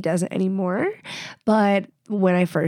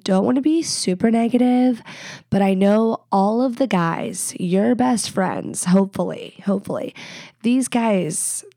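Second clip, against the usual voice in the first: usual speaking voice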